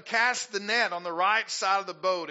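loud call to cast; Jesus instructing. Retold or imitated. Jesus telling disciples to cast the net, loud call. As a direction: call out the command. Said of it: "And he said to them".

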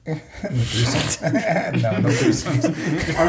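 slow laughing denial. Real, not acted. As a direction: laugh while denying it.

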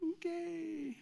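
high, soft, unlike own voice, skeptical; mock onlooker. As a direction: play a baffled bystander. Onlookers' awkward reaction.

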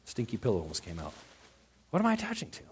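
ordinary talk, nothing marked

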